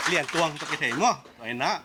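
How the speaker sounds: usual speaking voice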